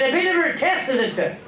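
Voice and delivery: high-pitched eager little character; high, comic little-fellow voice; little Paddy. Acted. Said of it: "Little Paddy come in".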